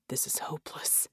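very soft despairing whisper; inner thought. A narrator's reading. Red silently despairs of the plan, hopeless whisper. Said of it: "he thought again".

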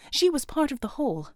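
plain reading voice

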